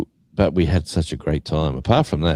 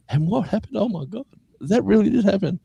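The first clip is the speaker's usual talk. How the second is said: very high put-on voice; mock shocked housemates. Housemates at home hearing of the holdup, mock shock. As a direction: imitate housemates aghast at the news. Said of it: "the others were at home going".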